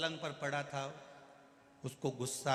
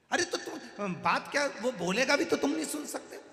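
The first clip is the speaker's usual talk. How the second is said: high, loud exasperated retort; exasperated shout; 10 semitones higher, 6 dB louder than usual. Acted. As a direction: throw the question back loudly. Grandson argues with deaf grandfather.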